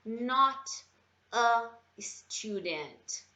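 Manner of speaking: very slow, word-by-word repetition; very slow repeat. Shown as a demonstration. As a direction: drill it for the students. (repetição bem devagar)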